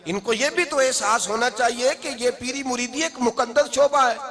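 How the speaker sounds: high-pitched, challenging sermon delivery; impassioned preaching. Acted. (ललकारते हुए जोशीली तक़रीर)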